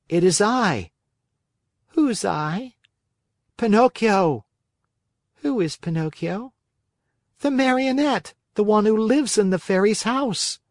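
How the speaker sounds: put-on high, light puppet boy voice; a lightly voiced eager marionette. Pinocchio answering the snail.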